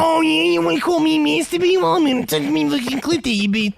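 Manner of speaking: high, brash put-on librarian voice; rowdy librarian impression. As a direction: play a crude, defiant librarian. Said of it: "That's your impression of a librarian?".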